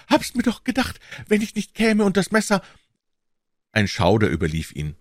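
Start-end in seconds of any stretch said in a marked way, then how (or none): 0.1-2.6 very high, despairing moan. A narrator's reading. Accused man confronted with his own knife, high moaning. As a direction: groan it out in anguish, pitched high. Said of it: "Dann stöhnte er".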